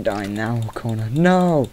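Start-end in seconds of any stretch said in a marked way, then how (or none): 1.1-1.7 sharp, much higher, louder cry; desperate shout to a dying friend; panicked no. Real, not acted. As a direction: yell it in alarm. Friend about to die.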